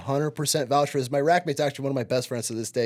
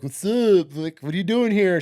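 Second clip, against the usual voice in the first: loud, higher macho Marine challenge; tough recruiter; 4 semitones higher, 3 dB louder than usual. Retold or imitated. Recruiter confronting my girlfriend at the station, cocky bark.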